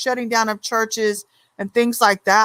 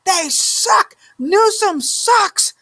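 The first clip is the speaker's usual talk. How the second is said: higher, slow, drawn-out mock rant; high, slow imitated rant at the DMV; imitating a loud complaint; 10 semitones higher, much slower than usual. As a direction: drag out her gripe in a raised voice. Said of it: "she was saying this out loud".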